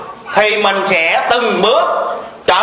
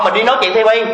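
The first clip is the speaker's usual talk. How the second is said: ordinary delivery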